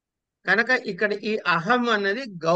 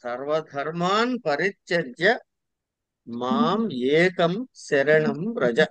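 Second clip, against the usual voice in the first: measured Sanskrit, phrase by phrase; slow verse recitation; 3 semitones lower, slower than usual. Real, not acted. Teacher reciting the scripture verse to a student, slow measured recitation. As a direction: draw out each phrase and pause between them.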